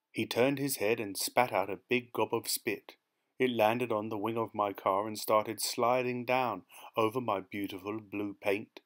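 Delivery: usual speaking voice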